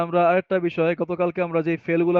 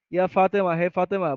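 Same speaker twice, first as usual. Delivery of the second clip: usual speaking voice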